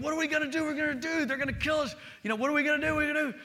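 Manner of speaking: high frantic mock panic; panicked kids screaming. Boy fleeing the car chasers, high panicked mock scream. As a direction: squeal it like a terrified kid. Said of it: "we're screaming".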